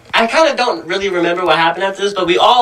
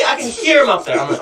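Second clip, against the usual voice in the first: ordinary talk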